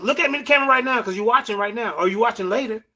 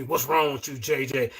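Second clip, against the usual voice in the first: mocking quote; 11 semitones lower, 8 dB quieter than usual. Host throwing the guest's question back at him, lower mocking mimicry. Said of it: "you got the nerve to ask me".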